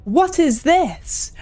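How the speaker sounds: ordinary delivery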